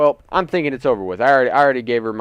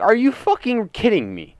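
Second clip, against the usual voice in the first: livid outburst; 6 semitones higher than usual. Retold or imitated. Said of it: "I was like".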